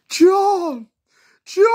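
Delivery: very high, very loud shriek of John; shrieking name. Retold or imitated. Kids shrieking to John from the window, full scream.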